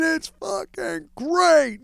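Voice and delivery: high, drawn-out jubilant cry; imitated celebratory yell. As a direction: imitate a player reveling in victory, slow and high. Player's answer while celebrating with booze.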